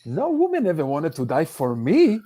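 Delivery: ordinary talk, nothing marked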